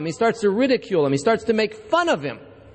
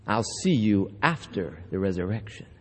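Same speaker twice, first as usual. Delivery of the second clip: much lower and softer, savoured; quiet repeat; 12 semitones lower, 6 dB quieter than usual. Shown as a demonstration. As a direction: murmur the line back to yourself. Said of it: "preacher repeats the punchline".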